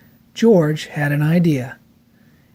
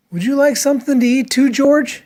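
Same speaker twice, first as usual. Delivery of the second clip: friendly question; 7 semitones higher than usual. A narrator's reading. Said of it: "asked the man with the yellow hat".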